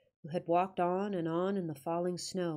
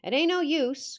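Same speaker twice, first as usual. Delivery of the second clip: louder, higher gruff Yorkshire growl; 8 semitones higher, 7 dB louder than usual. Acted. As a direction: growl it like a surly old guide. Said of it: "growled he".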